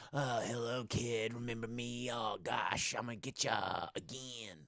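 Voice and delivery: taunting slasher-villain voice, drawn into character; Freddy Krueger. Acted. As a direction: play a sassy horror killer greeting his prey. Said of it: "It's 'cause he's like".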